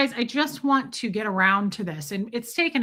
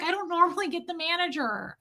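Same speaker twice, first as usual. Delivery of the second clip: much higher, panicky whine; freaking out; 8 semitones higher, 4 dB quieter than usual. Retold or imitated. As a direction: imitate pleading on the verge of meltdown. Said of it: "started, like, freaking out".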